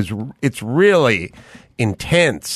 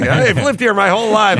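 lightly voiced old lady, much higher; a hint of a proud old woman, pitched up high; mocking old lady; 10 semitones higher than usual.

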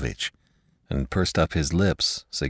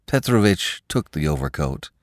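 ordinary delivery, nothing marked

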